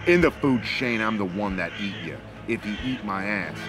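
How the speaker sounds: usual speaking voice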